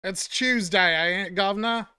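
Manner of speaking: hammed-up Cockney, "Tuesday" said as "Chewsday" and "governor" dropped to "guv'nor"; Cockney gutter accent. Streamer mocking a Cockney flower girl's accent. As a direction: do a mock Eliza Doolittle Cockney accent. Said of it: "fucking gutter accent, like Eliza Doolittle".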